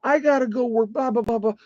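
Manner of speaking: high, strained mock rage at grandpa; furious outburst. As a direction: blow up at him in a high, hammed-up rant. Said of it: "I mean, I flipped out".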